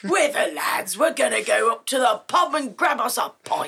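rowdy British pub-lad shout; British lad accent. Acted. Joins the British lad impression.

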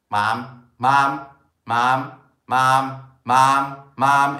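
loud, insistent repeated calling; nagging child. Acted. Kids grabbing a parent's attention on the phone, nagging. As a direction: pester loudly, each call identical.

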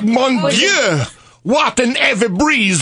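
put-on high, strained French-accented cry; french chef. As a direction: struggle against the wind in French accent. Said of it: "Homme marchant dans le vent".

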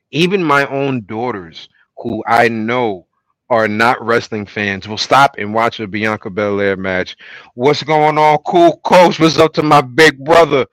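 usual speaking voice

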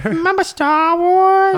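very high, slow, sing-song grape voice; Member Berries voice. Retold or imitated. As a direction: draw it out like a chirpy cartoon grape.

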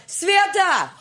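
high, loud name call; calling out loudly. Retold or imitated. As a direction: shout across the house. Dad yelling for mom.

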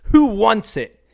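mock tough-guy yell; challenging shout. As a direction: call out the whole bus stop. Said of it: "He's like".

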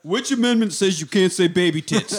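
mock indignant protest. Mock protest to an arresting officer.

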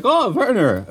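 Disney with a high, hammed German accent; mock German accent, drawn out. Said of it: "why am I giving Disney a German accent?".

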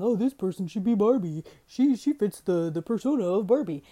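loud, mocking imitation of silly tweeters; mocking Twitter voice. Mocking the Twitter crowd, loud sneering voice. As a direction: sneer out their dumb suggestion loudly.

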